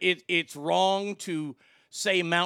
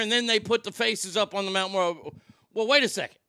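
ordinary talk, nothing marked

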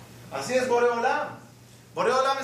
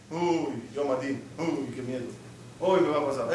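lower, hushed mock fright; mock fearful penitent; 6 semitones lower than usual. Rabbi voicing a man repenting out of fear, mock frightened. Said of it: "una persona que vuelve de Teshuvah por temor".

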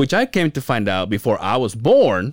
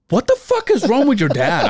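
incredulous mom; 8 semitones higher than usual. Retold or imitated. Mom quoted in disbelief.

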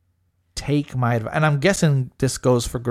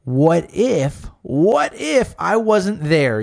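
higher, slower worried musing; a lightly voiced worried boyfriend; imitating boyfriend's worry; 5 semitones higher, much slower than usual. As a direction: voice his what-if worry, drawn out. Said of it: "he's over here thinking".

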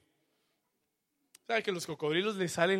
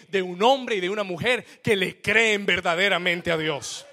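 loud, rising preacher's cry; full-force preaching; 5 semitones higher, 12 dB louder than usual. Acted. Preacher to his congregation, at full force. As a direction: preach it out to the whole church.

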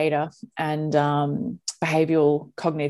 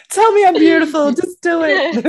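much higher, louder, giggling demand; laughing mock demand; 12 semitones higher, 10 dB louder than usual. Host joking to guest, a laughing mock plea. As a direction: beg for compliments through laughter.

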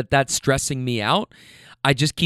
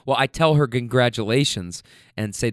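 normal talking voice

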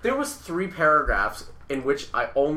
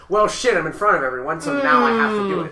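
mock dismay; 8 semitones higher, 8 dB louder than usual. Acts out reaction to sudden demand.

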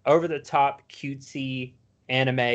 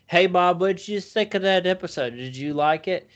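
dog voice; 6 semitones higher than usual. Retold or imitated. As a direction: pipe up like a dim-witted dog. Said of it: "And he'll say".

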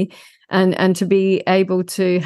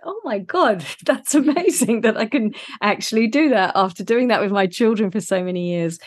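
retold delight, higher, with a laugh; light mock amazement at herself; amazed; 4 semitones higher than usual. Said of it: "And I was like".

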